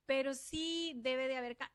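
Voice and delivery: ordinary talk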